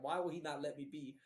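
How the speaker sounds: exasperated, building to a loud burst; mock-angry outburst in a retelling; retold exasperation. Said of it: "I was so mad".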